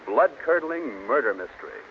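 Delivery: ordinary delivery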